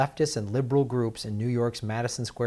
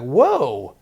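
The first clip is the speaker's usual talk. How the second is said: loud mock "whoa" of disbelief; loud imitated shock; retold astonishment; 8 dB louder than usual. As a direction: imitate a stunned reaction, loudly. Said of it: "they were like".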